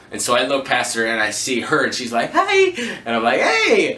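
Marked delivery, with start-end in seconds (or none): 2.3-3.0 very high, bright laughing hi; imitating her giddy greeting; excited hi. Date greets him excitedly. 3.4-3.9 very high, bright laughing hi; imitating her giddy greeting; excited hi.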